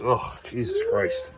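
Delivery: high, slow groan of disgust at the mess; drawn-out appalled exclamation; shocked disgust. Real, not acted. Big diaper mess discovered.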